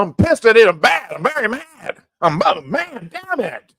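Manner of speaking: hammed-up scolding, pitch swooping high; badly faked anger at the guys; Trump, mock pissed. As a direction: pretend outrage, put on for show. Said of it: "like he was pissed at Israel".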